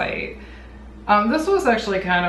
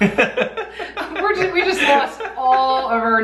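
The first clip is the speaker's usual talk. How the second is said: laughing; 6 semitones higher, much slower than usual. Real, not acted. Joking about losing Jersey subscribers.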